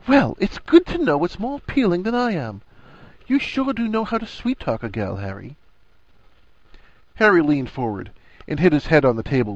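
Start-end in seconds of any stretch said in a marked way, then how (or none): 0.1-5.5 high-pitched mock-offended woman; show of huffing. A narrator's reading. Wife pretending offence at her friend, huffy. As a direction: huff theatrically, swooping high. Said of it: "made a great show of huffing".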